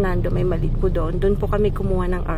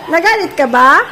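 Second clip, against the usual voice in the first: calling out; 7 semitones higher, 9 dB louder than usual. Real, not acted. Calling out to someone far.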